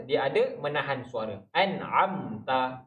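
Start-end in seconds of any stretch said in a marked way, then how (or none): 1.5-2.9 An'amta stretched very slowly, nun half flowing and half held; slow demo. Shown as a demonstration. Teacher drawing out An'amta very slowly for students. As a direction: draw out An'amta syllable by syllable. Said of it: "Dia ada, uh, mengalir suara".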